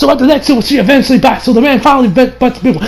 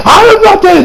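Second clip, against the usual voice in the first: high, loud refusal to quit; last stand cry; defiant yell; 9 semitones higher, 4 dB louder than usual. Retold or imitated. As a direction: shout it like a fighter getting back up. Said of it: "he's like".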